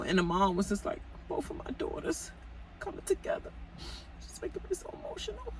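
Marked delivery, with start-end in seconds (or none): 1.3-5.5 high, breathy, unvoiced imitation of a teary mother; soft high whisper, mock emotional mom. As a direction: whisper it like a choked-up mom, fast at the end.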